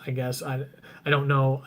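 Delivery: normal talking voice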